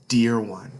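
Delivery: plain reading voice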